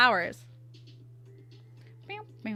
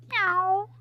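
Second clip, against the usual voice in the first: high-pitched playful meow; cat meow; 10 semitones higher than usual. Pretend. Streamer drawing a kitty character, high meow. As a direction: mimic a kitty's high mew.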